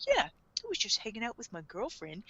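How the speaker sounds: soft, understated imitation; evasive cover story. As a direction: toss off the excuse quietly. Acting Light's evasive answer.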